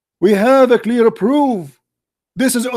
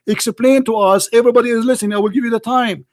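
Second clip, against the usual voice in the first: ordinary talk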